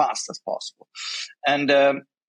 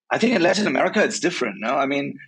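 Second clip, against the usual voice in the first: ordinary delivery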